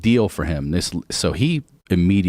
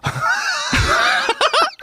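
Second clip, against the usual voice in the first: very high, helpless cackle; shrieking laugh. Real, not acted. Storyteller after they call the police, helpless high laughter. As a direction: burst out in a high squealing laugh. Cracks up at his own story.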